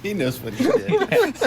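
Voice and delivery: laughing. Real, not acted. Laughing, slow, after the joke.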